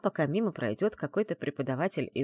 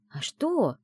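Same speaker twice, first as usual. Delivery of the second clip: innocent, higher-pitched query; unperturbed question; 7 semitones higher than usual. A narrator's reading. Friend answering heroine's amazed stare, unperturbed. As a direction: ask it as if nothing were odd. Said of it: "невозмутимо спросила Аяла".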